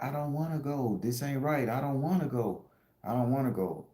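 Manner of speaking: high, drawn-together plaintive voice; retold pleading. Woman in the dream refusing to go, retold high pleading.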